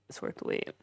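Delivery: near-whispered, soft repeat; softly echoed, almost without voice; hushed aside. Real, not acted. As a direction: drop it to a whispery undertone.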